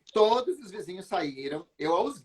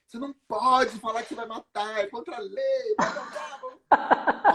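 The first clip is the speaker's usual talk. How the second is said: a hint of the lawyer husband, lightly higher, trailing into mocking blah blah; 7 semitones higher than usual. Lightly mocking her lawyer husband's warning about the law. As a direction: lightly mimic a lecturing husband. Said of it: "meu marido é advogado".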